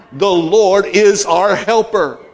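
preacher's triumphant declaration, slow and high; preaching. Acted. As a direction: drive it home to the church. Preaching climax.

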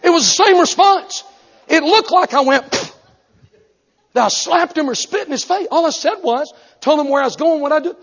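high, loud indignant preaching; preaching at full force. Acted. Preacher telling congregation how he was treated, loud and high. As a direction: belt it out, outraged.

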